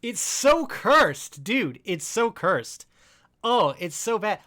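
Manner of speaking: drawn-out, high, horrified wail; loud disgusted groan. Real, not acted. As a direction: stretch out the disgust loudly. Reacting to own shaved face.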